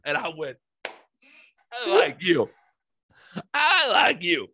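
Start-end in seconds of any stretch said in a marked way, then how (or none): 1.7-4.5 high, loud put-on gushing, climbing on the repeat; mock gushing. Patient delighted with his nurse, hammed-up gushing. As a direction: gush it way up high, even higher second time.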